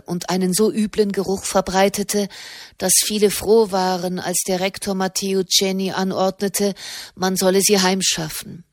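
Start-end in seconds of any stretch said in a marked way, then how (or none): none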